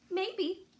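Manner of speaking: high, loud coy drawn-out maybe; teasing maybe. Acted. Sophie teases Wendell, high and coy. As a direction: dangle it in front of him.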